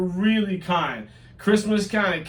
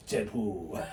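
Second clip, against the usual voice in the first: fading echo; 9 semitones lower, 10 dB quieter than usual. Acted.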